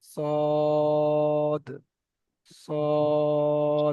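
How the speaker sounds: long, drawn-out "সদ" with rounded lips; rounded mouth. Shown as a demonstration. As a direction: hold "সদ" long and full on a round O. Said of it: "এর মুখ গোল করে বলতে হবে". (মুখ গোল করে টেনে "সদ")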